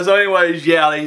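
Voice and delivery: ordinary talk, nothing marked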